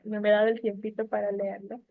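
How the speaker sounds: ordinary talk, nothing marked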